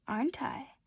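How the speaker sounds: softly whispered coy reply; sweet whisper. Acted. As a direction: breathe it out, pleased with herself. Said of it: "the flower answered sweetly".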